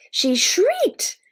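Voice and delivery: very high narrating squeal; mock shriek. A narrator's reading. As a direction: pitch it up like a small shriek.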